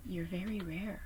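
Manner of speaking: intimate whisper. Real, not acted. Reassuring her viewers that they are rare, in a whisper. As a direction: whisper it like a secret.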